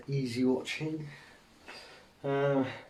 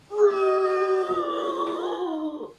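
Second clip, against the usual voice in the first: high, long-held dinosaur screech; dinosaur impression. Shown as a demonstration. Streamer showing viewers a dinosaur impression, high screeching roar. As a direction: screech out a drawn-out dinosaur cry. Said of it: "that's my dinosaur impression".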